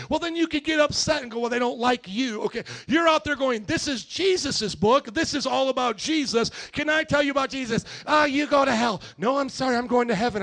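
loud, emphatic sermon delivery; forceful preaching. Real, not acted.

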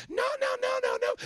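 put-on very high little-girl no; toddler protesting. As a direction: squeal it like a small child.

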